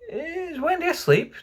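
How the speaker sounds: very high, lifted little voice; high-pitched innocent query; curious child's question. A narrator's reading. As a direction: ask it like a small wondering boy. Boys ask about sleeping Wendy.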